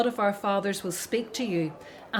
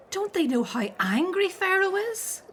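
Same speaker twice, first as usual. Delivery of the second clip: sharply higher, alarmed question; 9 semitones higher than usual. Acted. Miriam, alarmed that the foremen will plead with Pharaoh. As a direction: gasp it out in disbelief and fear.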